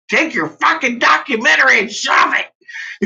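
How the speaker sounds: yelling at the documentary voice; angry shout. Retold or imitated. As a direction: shout him down, fed up. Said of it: "I'm like".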